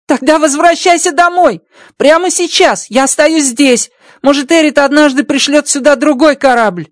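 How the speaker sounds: high alien voice, insistent; Rocky ordering his friend to go home; urgent alien voice. Acted. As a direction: push your friend to leave now. Rocky urges him home.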